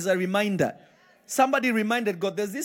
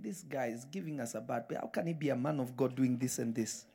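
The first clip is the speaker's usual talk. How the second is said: much softer, grumbling under the breath; muttering Pharisees; 7 semitones lower, 11 dB quieter than usual. Retold or imitated. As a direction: gossip it low like a disapproving Pharisee. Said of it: "the Pharisees were looking and saying".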